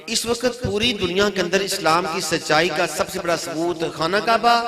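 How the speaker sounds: high, rising challenge to the congregation; rhetorical question. Real, not acted. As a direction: pitch it up toward a questioning end. Rhetorical question he then rejects.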